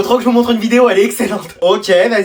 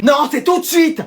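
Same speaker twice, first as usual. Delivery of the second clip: high, insistent mother's shout; mother yelling; 6 semitones higher, slower than usual. Acted. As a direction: order your kid sharply, pitched high. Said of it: "t'as fait que de me crier dessus". (cri de mère autoritaire)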